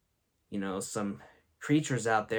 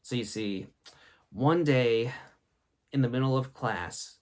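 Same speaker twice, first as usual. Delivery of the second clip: usual speaking voice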